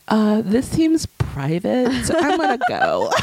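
slow, high-pitched awkward exit; imitated polite retreat, ending in a laugh; Blair. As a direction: excuse yourself delicately, drawn out. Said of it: "Blair, she's like".

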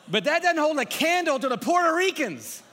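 big excited preacher shout. Real, not acted. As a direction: shout it out and hype the room. Hyping the Puerto Ricans in crowd.

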